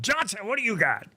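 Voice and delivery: the editor redone in a put-on English accent; English accent attempt. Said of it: "I can't do an English accent".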